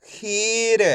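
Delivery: खीर with a long ee and short final r; stretched pronunciation. Shown as a demonstration. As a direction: hold खी, then lightly release र in खीर. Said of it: "एक बार फिर से देखिए".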